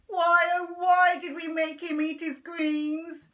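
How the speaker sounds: loud, high hammed-up lament; Dad moaning in mock grief. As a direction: wail it melodramatically. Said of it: "Dad would moan".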